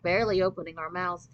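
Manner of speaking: usual speaking voice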